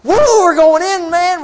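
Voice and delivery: loud, high, giddy whoop; excited cheer. Retold or imitated. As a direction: cheer like an eager crowd at the border. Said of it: "they're really excited, they say".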